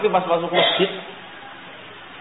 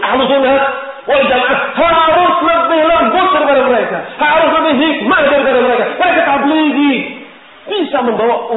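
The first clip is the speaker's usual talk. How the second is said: "harus lebih lembut" cried out high and loud; fervent exhortation; 10 semitones higher, 5 dB louder than usual. Real, not acted. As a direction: preach it with full force. Preacher exhorting the congregation.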